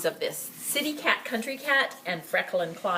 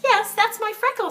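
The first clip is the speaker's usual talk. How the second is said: put-on 1 boy's voice; 14 semitones higher, 6 dB louder than usual. A narrator's reading. Boy claims the cat.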